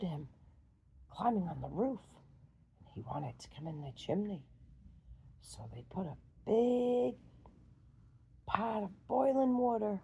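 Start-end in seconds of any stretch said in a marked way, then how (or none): none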